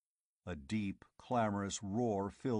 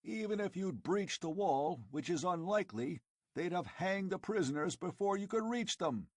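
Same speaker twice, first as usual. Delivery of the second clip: higher, impatient barbarian voice; Conan explaining; 8 semitones higher than usual. Acted. Conan explaining to the tribesmen, impatient. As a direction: lecture the fools.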